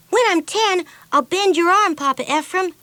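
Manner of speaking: put-on high, bright girl's boast; a cheeky little girl taunting her papa; Pippi's voice. A narrator's reading. Pippi teasing her father, high girlish boast.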